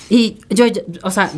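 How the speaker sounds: ordinary talk, nothing marked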